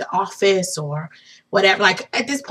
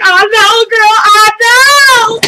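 high, loud, stretched-out squeal; shrieky acted agreement; retold excited wail; 11 semitones higher, 7 dB louder, slower than usual. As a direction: wail back in shared disbelief. Screaming back at shocked sister-in-law.

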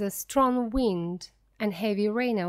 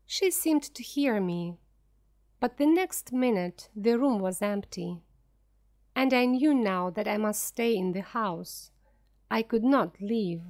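usual speaking voice

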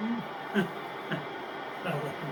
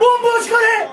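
shout; 7 semitones higher, 4 dB louder than usual. Real, not acted. Frustrated at empty-goal miss.

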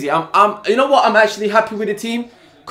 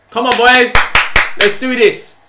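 loud rallying call; 5 semitones higher, 6 dB louder than usual. Real, not acted. Fan hyping up his team, loud rallying call. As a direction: fan shouting encouragement at the screen.

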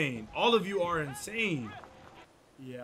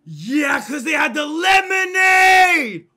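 very loud, high, drawn-out shout; exasperated yell; 11 semitones higher, 18 dB louder, slower than usual. Real, not acted. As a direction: scream the obvious answer at the game. Yells back at the game.

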